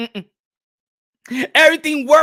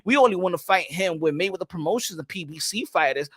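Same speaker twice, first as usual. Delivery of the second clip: quoting Mayweather; 6 semitones lower, 7 dB quieter, faster than usual. Retold or imitated. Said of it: "Floyd Mayweather ... said".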